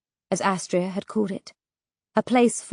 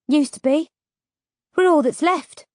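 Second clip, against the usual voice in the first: mildly stern, raised pitch; 5 semitones higher than usual. A narrator's reading. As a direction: state it firmly, a little higher. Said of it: "Petra said sternly".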